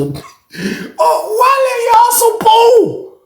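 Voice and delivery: very high, slow, breaking laugh; crying laughing. Real, not acted. Teller cracking up at Unc, high laughing cry.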